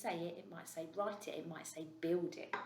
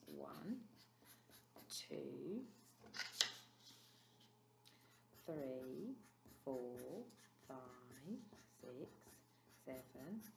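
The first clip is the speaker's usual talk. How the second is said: slow counting; 3 semitones lower, 10 dB quieter, much slower than usual. Shown as a demonstration. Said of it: "I'm gonna draw thirteen".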